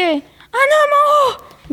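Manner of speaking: high, drawn-out cry of alarm; acted high-pitched warning, stretched out. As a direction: call out a sudden frightening sighting. Acted sudden alarm at a sighting.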